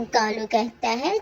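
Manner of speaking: usual speaking voice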